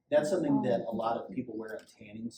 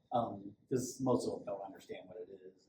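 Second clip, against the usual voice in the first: ordinary talk, nothing marked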